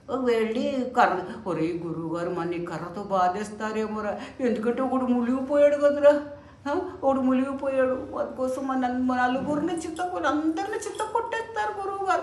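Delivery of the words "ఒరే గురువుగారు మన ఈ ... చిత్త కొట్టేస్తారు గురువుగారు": worried disciple whispering fears. Acted. Disciples fear beating.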